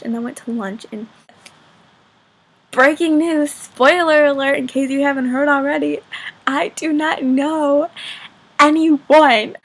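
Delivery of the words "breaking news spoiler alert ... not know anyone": loud, emphatic fake breaking-news announcement; mock news bulletin. New student announcing she knows no one, mock bulletin. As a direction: announce it big, ending slow and loud.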